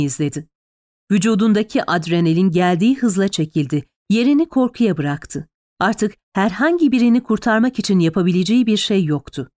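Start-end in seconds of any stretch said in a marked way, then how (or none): none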